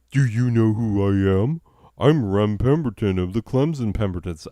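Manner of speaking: 略带夸张地模仿自负明星，声音稍响; 摆出“你知道我是谁吗”的架子; 傲慢名人腔. Retold or imitated. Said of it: "So was my response like".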